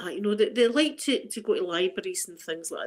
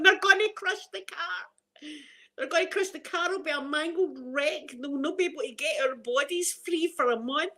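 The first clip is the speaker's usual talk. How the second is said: hammed-up dread of being crushed; overreacting panic; 6 semitones higher than usual. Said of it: "I overreact at times".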